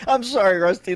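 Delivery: ordinary talk, nothing marked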